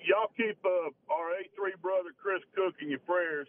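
usual speaking voice

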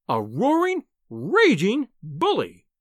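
high, drawn-out scolding; high, slow, emphatic fury; raging reproach. A narrator's reading. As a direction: hurl each word at your husband. Wife berating her husband.

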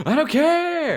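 high, mocking sung-out I don't care. Friend mocking a blunt reply, whiny rock-singer echo. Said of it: "That's a very Weezer thing to say".

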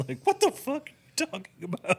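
high, outraged disbelief; mocking the dresses plan, pitched high in disbelief; incredulous. Real, not acted. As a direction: react as if it's the dumbest idea ever. Mocking dumb idea.

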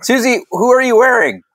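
bubbly red carpet host; gushing red carpet chatter. Acted. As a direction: gush like an entertainment reporter.

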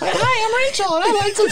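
very high squeaky mock introduction; hammed-up falsetto impression of a partner; silly random voice. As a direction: squeal it out as a goofy put-on character. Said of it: "like, just random voices".

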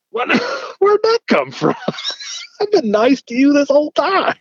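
high, incredulous protest breaking into laughs; mock indignation. Officer answering a sudden insult, laughing indignant protest. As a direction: protest in hammed-up hurt disbelief. Teller's shocked reply to insult.

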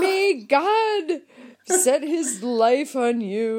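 lightly voiced sweet old grandma, slow; a hint of a pious grandmother blessing; mock pious grandma. Reading grandma's cards aloud, a hint of pious grandma. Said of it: "that sounds like Grandma".

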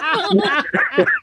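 a quiet, high burst of laughter. Real, not acted. Caller cracking up retelling the snake story, quiet high laugh.

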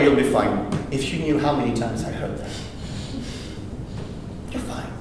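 ordinary delivery

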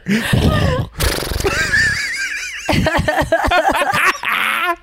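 high shrieking laugh. Real, not acted. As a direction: burst out in a screaming laugh. Laughing at the queef joke.

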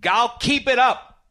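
loud, high, slowed protest; heated protest. Real, not acted. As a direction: shout your defence, dragging it out. Defending himself, heated and shouting.

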